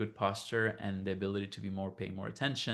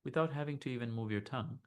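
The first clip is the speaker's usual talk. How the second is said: soft, unhurried meditation voice; guided meditation; 7 dB quieter than usual. Acted. Teacher leading listeners to notice body and heart, calm meditation voice. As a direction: gently guide them inward, leaving long silences.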